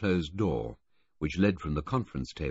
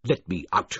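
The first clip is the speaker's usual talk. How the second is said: furious; 5 dB louder than usual. A narrator's reading. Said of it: "Filmer said furiously".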